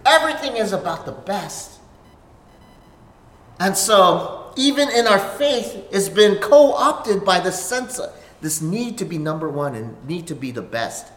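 ordinary talk

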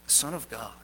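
soft, low reverent repeat; hushed solemn echo. Real, not acted.

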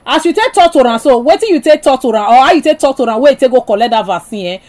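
ordinary delivery

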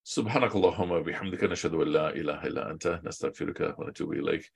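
quick, low-key recited Arabic prayer; rapid Arabic recitation. Shown as a demonstration. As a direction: rattle off the Arabic dua fast and under the breath. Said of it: "when I speak these random Arabic things".

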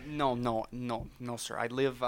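put-on friendly neighbour with a mild Canadian accent. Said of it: "You sound like you're from Canada".

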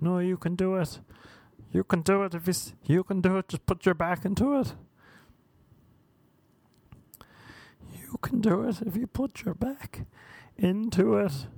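high coaching voice, lightly put on; mock encouraging friend. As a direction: cheer them on like a pushy coach.

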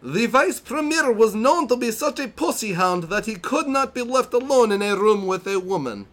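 high-pitched mock Italian accent; over-the-top Borat-esque Italian accent; hammy Italian-Borat accent. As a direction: read it in a ridiculous Italian accent going into Borat. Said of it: "I'm gonna read it in the best accent that I can".

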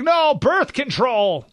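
high, slow mock Hillary. Host mocking Hillary's answer to voters, high and drawn out. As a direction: stretch it out as a mocking Clinton voice. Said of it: "And Hillary's like".

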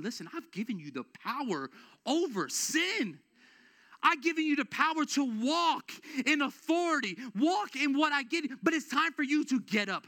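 loud, high declaration as God; preaching at full force. Acted. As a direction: proclaim God's word to the church with power. Voicing God's charge.

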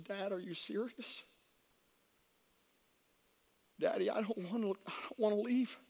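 understated tearful apology, retold; imitated crying daughter. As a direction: act out a tearful teen begging Dad, gently.

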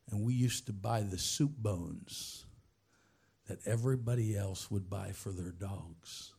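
low-pitched, sober retelling; understated, solemn recollection; subdued confession. Retold or imitated. Retold confession of childhood poverty.

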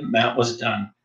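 ordinary delivery, nothing marked

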